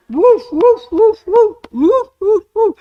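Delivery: high, loud playful dog barks; barking woofs. Pretend. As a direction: bark like a happy little dog. Barking like a dog, Christmas theme.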